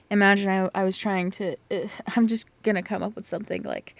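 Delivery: plain reading voice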